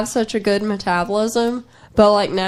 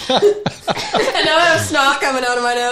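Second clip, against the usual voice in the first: laughing; 5 semitones higher than usual. Real, not acted. Embarrassed laughing after being scolded.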